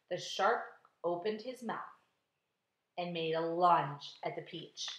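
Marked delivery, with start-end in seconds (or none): none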